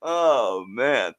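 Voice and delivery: ordinary talk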